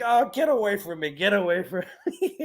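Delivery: high-pitched mock rebuff; Kane Hodder grumbling. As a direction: fend off a hug like a playful curmudgeon. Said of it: "he's like a curmudgeon at times".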